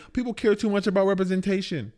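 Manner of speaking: mock the people who complain; mocking quote. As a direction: mimic them with a sneer. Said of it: "And people say".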